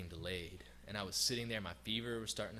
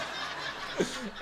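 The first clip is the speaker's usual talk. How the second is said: a sudden bright burst of laughter; laughing at the memory of the seatmate; real laugh; 14 semitones higher, 4 dB louder than usual. Real, not acted. Laughing at the German seatmate's warning.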